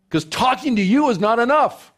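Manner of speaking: high, loud exaggerated plea; high mock whine. Pastor mimicking a gossip talking to God, high exaggerated whine. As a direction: squeal like a gossip.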